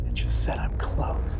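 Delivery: hushed whisper after a gasp; startled whisper. Real, not acted. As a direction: whisper it, freshly spooked.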